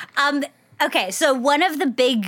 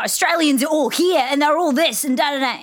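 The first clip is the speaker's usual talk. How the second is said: higher, mildly hammed-up Australian bluster; mock the pushy Australian manner, pitched up; brash Australians; 4 semitones higher than usual. Guest contrasting accents, mocking full-on Australians. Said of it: "What's the difference between a New Zealand and Australian accent?".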